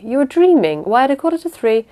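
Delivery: high, loud, excited disbelief. A narrator's reading. Aunt doubting Gertrude's account, exclaiming excitedly. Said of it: "I said excitedly".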